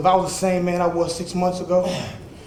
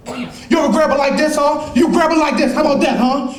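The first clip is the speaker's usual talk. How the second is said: mock taunt; 7 semitones higher than usual. Mocking friend who hits his girlfriend.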